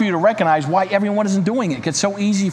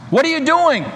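high, nervous cry of a cave dweller; a frightened question called into the dark; 7 semitones higher than usual. Acted. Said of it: "cried one, very nervous".

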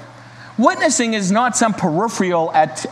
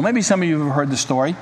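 usual speaking voice

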